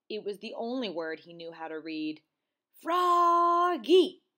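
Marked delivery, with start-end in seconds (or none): 2.8-4.2 long, high shout of the name; reading aloud loudly. A narrator's reading. Froggy reading the only word he knows, loud shout. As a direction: belt the word out, stretched. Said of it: "He read it aloud, louder and louder".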